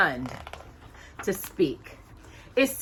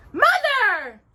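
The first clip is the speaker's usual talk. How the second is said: much higher, louder outcry; shocked cry; 7 dB louder than usual. Acted. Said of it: "cried out in shock".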